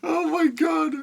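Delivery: very high, stunned disbelief; stunned oh my god. Real, not acted. Streamer cries out in disbelief at the pull. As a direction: gasp it out in total disbelief.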